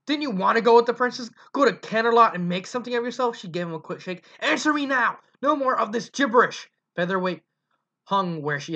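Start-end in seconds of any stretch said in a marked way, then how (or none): 0.1-3.4 angry mother. Acted. Mother shaking her son. 4.4-6.7 angry demand. Acted. Mother shaking her son.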